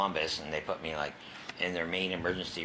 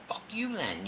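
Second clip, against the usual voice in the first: high, snapped-back fuck you; imitating his own angry retort; mock defiance; 12 semitones higher than usual. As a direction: fire it back, pitched up. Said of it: "So I was like".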